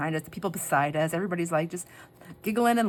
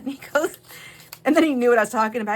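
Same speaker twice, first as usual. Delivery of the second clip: ordinary delivery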